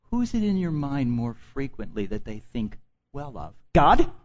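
ordinary talk, nothing marked

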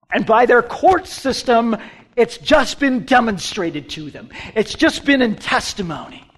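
loud, high preaching delivery; forceful declaration. Real, not acted. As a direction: proclaim the verdict at full force.

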